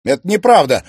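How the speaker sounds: breathless, stammered denial; shocked denial. Acted. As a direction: gasp out the denial. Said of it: "выдохнул он".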